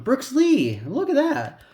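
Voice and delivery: high, loud delighted outburst; real excitement. Real, not acted. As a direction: exclaim over a great pull. Delighted at pulling a favoured player.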